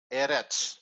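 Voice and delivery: Eretz with the stress on its first syllable, E-retz. Shown as a demonstration. Teacher showing where the stress is, first-syllable stress on Eretz. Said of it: "is on the first syllable".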